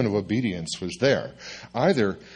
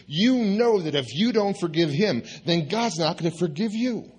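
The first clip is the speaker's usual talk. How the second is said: higher, preachy imitation of a friend; lecturing friend; 9 semitones higher than usual. Les warning Mike about God's forgiveness, high mock lecture. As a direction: wag a finger and preach it up high.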